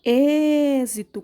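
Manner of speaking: ÊXITO with a loud, long closed ê; closed vowel demo. Shown as a demonstration. Teacher shows pupils a closed sound, drawn-out loud ÊXITO. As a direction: hold the opening ê of êxito, then finish. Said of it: "Quando eu vou fechar mais a boca".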